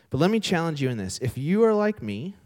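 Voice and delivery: normal talking voice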